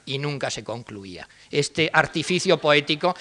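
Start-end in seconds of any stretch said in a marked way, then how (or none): 0.1-1.3 "nunca" stressed and falling, the line trailing off softly; quiet mock-poetic close; soft verse ending. Shown as a demonstration. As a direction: let "y nunca se concluía" fade at the end. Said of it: "añade el malvado Pérez de Ayala".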